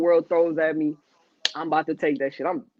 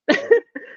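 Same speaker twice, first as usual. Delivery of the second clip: sudden high laugh; high burst of laughter; 4 dB louder than usual. Real, not acted. Man laughing at how life snatched him back. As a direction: laugh at the irony.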